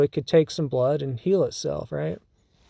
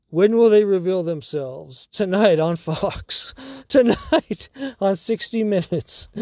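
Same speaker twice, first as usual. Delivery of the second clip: hammed-up teaser voice for a network special; mock TV announcer; 3 semitones higher than usual. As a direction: tease the big reveal like a TV promo, half laughing.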